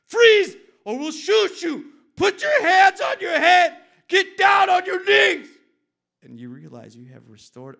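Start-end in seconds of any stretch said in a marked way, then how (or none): 0.1-5.5 high screaming cop commands; full-force, high-pitched police shout; shouted cop cliches. Acted. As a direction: yell it like a cop at gunpoint. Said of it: "as you spew out their own cliches".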